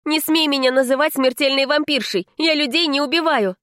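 put-on affronted girl setting him straight; indignant. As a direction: snap back at the insult. Heroine angrily rejects insulting nickname.

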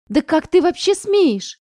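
slow, loud, high indignation; outraged. Acted. Mother outraged at daughter's reply, slow loud fury. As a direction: spit out each word in outrage.

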